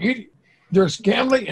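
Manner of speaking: ordinary talk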